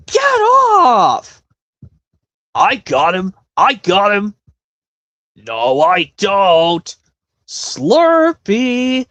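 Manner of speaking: high, loud squeaky cartoon voice; excited cartoon creature yelling; cartoon character impression. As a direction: shout it like a cartoon critter, way up high. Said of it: "this impression very well off the top of my head".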